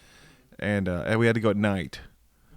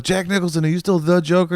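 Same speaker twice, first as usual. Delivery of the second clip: high, louder eager asking; interviewer voice; 8 semitones higher, 5 dB louder than usual. Retold or imitated. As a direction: play a keen interviewer. Voicing an interviewer.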